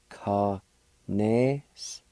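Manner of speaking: Kanestoti drawn out slowly as one word; slow whole word. Shown as a demonstration. Teacher slowly joining the syllables for learners. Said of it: "Pronounced like this".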